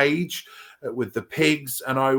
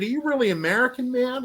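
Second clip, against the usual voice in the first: pitched-up, fast Owen Wilson voice; Owen Wilson impression; 10 semitones higher, faster than usual. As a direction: do a breezy Californian surfer voice. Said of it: "and he sort of walked past and said".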